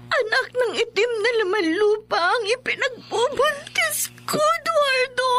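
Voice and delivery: wife confessing to her husband through sobs; sobbing confession. Acted. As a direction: break down as you confess. Wife confesses demon pregnancy, crying.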